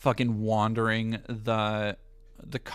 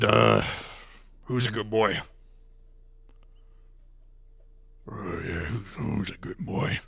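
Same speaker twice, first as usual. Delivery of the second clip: much lower gruff Alex Jones dog praise; 4 semitones lower than usual. Pretend. Viewer asks for Alex Jones, he growls dog praise. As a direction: growl it like a conspiracy host. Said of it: "in your Alex Jones voice".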